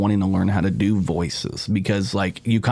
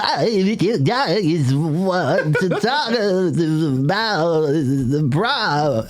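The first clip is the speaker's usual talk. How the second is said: imitating Martin Phillips; 7 semitones higher, much slower than usual. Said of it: "Martin Phillips would just be like".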